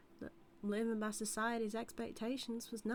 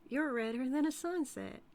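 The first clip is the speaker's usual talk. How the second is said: gleeful teasing; 5 semitones higher, 5 dB louder than usual. Acted. Teasing blushing sheriff.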